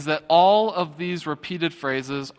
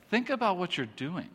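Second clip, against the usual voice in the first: soft, halting rebuke; hushed, pause after "think", then press it; admonishing; 5 semitones higher, 7 dB quieter than usual. Acted. God to his people over the ruined temple, hushed admonishing. God's rebuke in parent's phrase.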